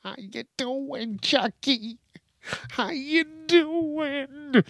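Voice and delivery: high, slow, drawn-out caricature of his mother; mock mother voice. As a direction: stretch it out high like a cartoon mom. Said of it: "Got a call from my mother".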